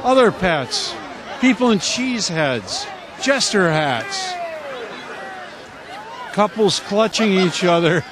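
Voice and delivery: announcer calling over a noisy crowd; live play-by-play. Real, not acted. Live commentary on the plunge.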